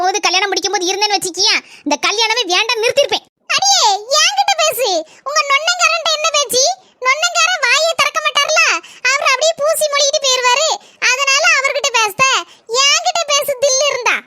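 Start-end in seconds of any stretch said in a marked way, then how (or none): 3.6-14.2 angry challenge. Acted. Wife challenging sister-in-law to argue with her, angry. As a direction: dare her to take you on.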